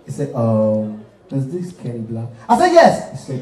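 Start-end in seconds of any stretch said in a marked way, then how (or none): 0.3-2.3 deep rich man. Acted. 2.7-3.1 shouted high yes; loud yes. Acted. Answering loud on phone.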